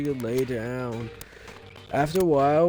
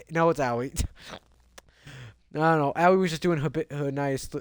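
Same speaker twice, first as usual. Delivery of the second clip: ordinary talk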